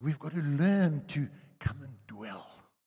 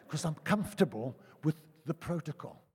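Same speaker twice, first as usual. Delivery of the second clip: usual speaking voice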